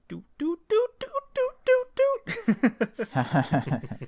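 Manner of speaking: high-pitched repeated electronic doots; high sing-out doots breaking into a laugh; imitated Gundam sound effect. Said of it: "use the Gundam sound".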